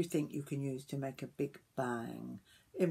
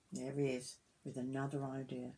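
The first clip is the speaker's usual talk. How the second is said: usual speaking voice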